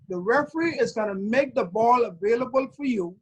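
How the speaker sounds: usual speaking voice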